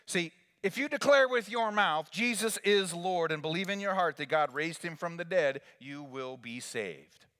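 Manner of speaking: high, loud call for the congregation to answer; rally the room to say it back; preaching at full force. Acted. Preacher leads congregation response.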